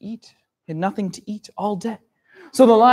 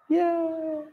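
cheering; 8 semitones higher, 5 dB louder than usual. Acted. King hare safe, story ending.

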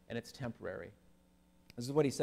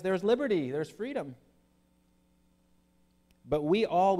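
louder, ringing preacher's reading of the verse; preaching scripture; 5 dB louder than usual. Acted. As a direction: proclaim the verse to the congregation. Reads scripture at full force.

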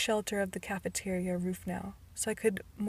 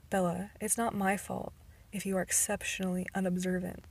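ordinary delivery, nothing marked

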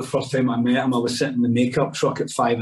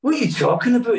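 Billy Connolly; 9 semitones higher, faster than usual. Retold or imitated. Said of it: "he's like".

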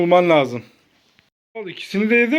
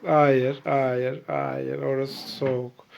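low voice fading softer; cooling-off cue; 9 semitones lower, 7 dB quieter, much slower than usual. Pretend. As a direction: let the voice sink as she strays. Hot-cold game, child moving away.

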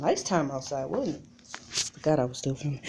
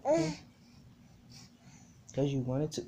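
usual speaking voice

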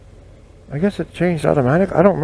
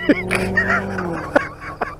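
high, softer real giggling fit; helpless laughter; 5 dB quieter than usual. Real, not acted. Storyteller amused by his own imitation, high helpless laughter. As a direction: dissolve into laughter.

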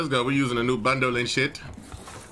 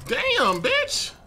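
exasperated yelp. Real, not acted. Reacting to game frustration.

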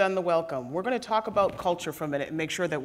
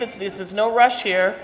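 normal talking voice

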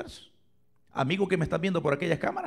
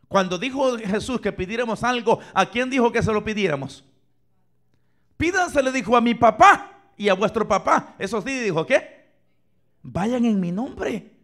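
rising preacher voice, pushing hard; preaching; 5 semitones higher, 5 dB louder than usual. Real, not acted. Pastor preaching to the church, forceful. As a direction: preach it out to the congregation.

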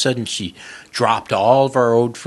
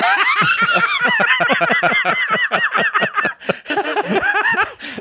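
long, very high burst of laughter; real uproarious high laugh; 4 dB louder than usual. Real, not acted. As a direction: crack up helplessly. Cracking up at own story.

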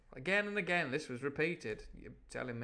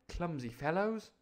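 put-on higher boyish six-year-old's voice; Jim; 5 semitones higher than usual. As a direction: play a sniffy little boy, pitched up. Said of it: "I'll do that in Jim's voice".

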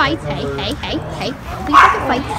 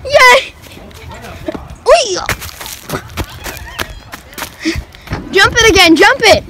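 excited cheering; 9 semitones higher than usual. Real, not acted. Cheering the dog's jumps.

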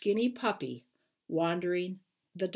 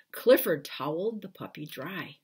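plain reading voice

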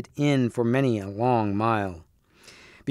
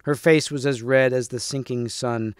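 plain reading voice

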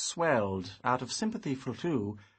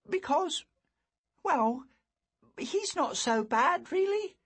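put-on high hesitant boy voice; a boy searching for words; 3 dB louder than usual. A narrator's reading.

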